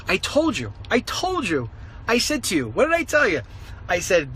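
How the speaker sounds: ordinary delivery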